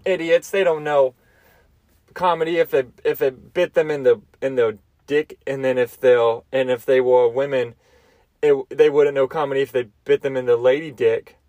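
usual speaking voice

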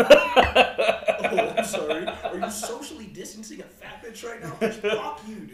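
loud laughing sarcastic apology; hammed-up indignation, very loud; mock outrage. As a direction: friend loudly mocking Josh for dancing at a distance. Retold mocking of Josh.

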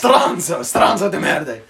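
loud Italian curse, laughing at the end; Italian insult. Pretend. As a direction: spit it out like an angry Italian. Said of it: "He even used the word stronzo".

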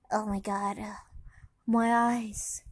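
normal talking voice

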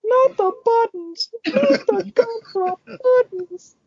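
high, slow, pleading little cookie voice; beg, squeaky and drawn out; gingerbread man voice. Pretend.

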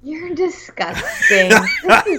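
slow, laughing, playful disgust; laughing mock disgust. Real, not acted. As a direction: tease them through a laugh.

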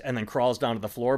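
ordinary talk

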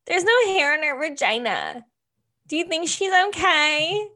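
high nasal caricature of a daughter; daughter's voice. Acted. Daughter reporting to dad on the lady, high voice. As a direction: innocent question in a very high nasal voice. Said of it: "and she's like".

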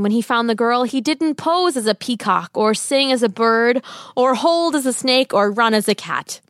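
ordinary delivery

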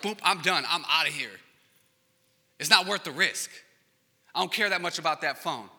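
voicing the thief. Thief voiced fleeing.

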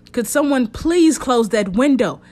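detective calling out over the gusting wind; called out. Acted. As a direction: shout the request across the room. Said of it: "Bailey called out".

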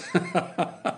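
short scornful chuckle; a king laughing at his own joke; mocking laugh. A narrator's reading. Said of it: "his laugh was chorused".

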